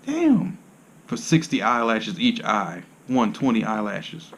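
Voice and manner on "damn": a much higher, squeaky shock on Damn; a mock gasp at three hundred fifty dollars. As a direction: react to the price in disbelief. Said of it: "I'm like".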